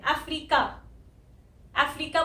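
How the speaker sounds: 'अफ्रीका' as a-FREE-ka, long middle vowel; common mispronunciation. Retold or imitated. Teacher repeats what learners may have heard, mispronounced 'अफ्रीका'. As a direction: stretch the 'ree' in 'अफ्रीका'. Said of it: "इसको हम अफ्रीका नहीं बोलते".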